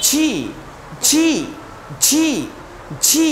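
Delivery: each জি pressed and drawn out; hard G. Shown as a demonstration. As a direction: repeat জি with a firm j and long ee. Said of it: "এটা শক্ত করে বলতে হবে".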